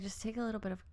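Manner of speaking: softly quoted, laid-back advice; imitated chill advice. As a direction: play someone quietly talking you down. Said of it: "He's like".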